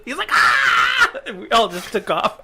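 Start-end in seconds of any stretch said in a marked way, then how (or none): 0.3-1.1 very high mock shriek; imitated scream. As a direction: shriek like the kid under the snowball. Imitating kid screaming under snowball.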